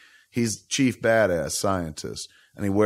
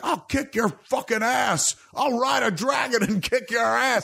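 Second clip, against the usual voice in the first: much higher, shouted tough-guy boast; macho Jor-El; 13 semitones higher than usual. Retold or imitated. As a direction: bellow it like a brawler dad. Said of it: "Jor-El in this one is like".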